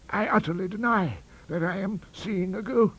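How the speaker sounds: high, rising protest; cling frantically to disbelief; desperate denial. A narrator's reading. Madman desperately denies a ghost.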